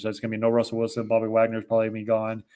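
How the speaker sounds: ordinary talk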